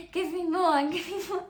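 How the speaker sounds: mock-greedy laughing plea; laughing, giddy demand. Retelling the epidural high.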